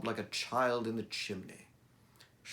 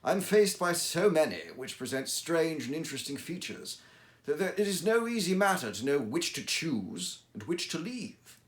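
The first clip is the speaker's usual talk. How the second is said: louder, livelier audiobook reading of a Holmes tale; storyteller voice, higher and fuller; story narration; 4 semitones higher, 5 dB louder than usual. A narrator's reading. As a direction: read it like a Victorian narrator recounting his cases. Reads Sherlock Holmes story aloud.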